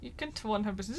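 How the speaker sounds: ordinary talk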